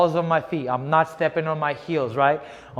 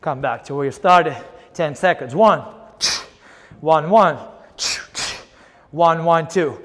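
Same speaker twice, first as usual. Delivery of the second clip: boxing coach calling combos. Acted. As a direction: drive the class through the round. Coach calling drill live.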